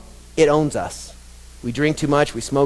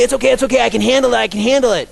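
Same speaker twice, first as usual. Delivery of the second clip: higher, louder breezy insistence; mock reassurance; 9 semitones higher, 4 dB louder, much faster than usual. Mocking boast again.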